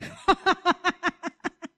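giddy burst of laughter; excited laugh. Acted. Blind man laughing with joy at the news. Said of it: "got so excited".

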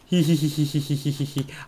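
rapid hee-hee-hee giggle; quick run of tittering; gleeful giggle. Real, not acted. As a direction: titter gleefully over your favourite plane. Delighted by favourite plane.

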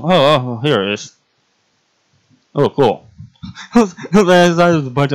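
ordinary delivery

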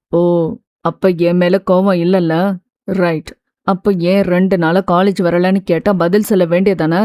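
low-pitched male character, mildly challenging; lightly voiced young man. A narrator's reading.

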